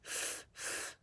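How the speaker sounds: "f, f" as pure breath through the teeth, no voice; teacher modelling the f sound in breath only; voiceless f sound. Shown as a demonstration. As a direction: hiss out a soft "f". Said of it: "F makes the sound".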